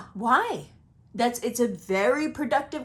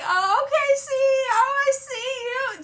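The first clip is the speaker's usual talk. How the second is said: much higher, admiring "oh, okay"; impressed surprise; 3 dB louder than usual. Retold or imitated. Astrologer showing how she'd react seeing someone's image, high impressed gush. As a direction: gush like you're impressed at first sight. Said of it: "I should be like".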